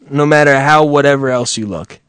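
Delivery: normal talking voice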